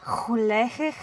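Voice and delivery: "Gelukkig" with the G scraped in the throat; throaty Afrikaans G. Shown as a demonstration. Sibling practising happy birthday in Afrikaans, throaty G. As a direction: clear your throat on the G of "Gelukkig". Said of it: "It's the G's".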